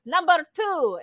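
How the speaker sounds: loud, high game call; mock teacher's big announcement; imitated acting teacher. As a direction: call out the count like a game leader. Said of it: "he'd clap his hands and go".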